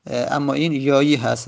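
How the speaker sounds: ordinary talk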